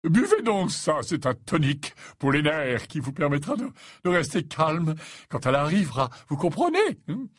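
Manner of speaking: high, put-on professor voice; coaxing old professor. Slughorn handing Ron the tonic, coaxing.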